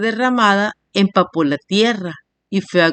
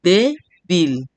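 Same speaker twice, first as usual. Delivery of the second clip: "débil" in two beats, DÉ, BIL; split into syllables. Shown as a demonstration. Teacher giving a two-syllable example, "débil" broken in two. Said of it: "dos sílabas".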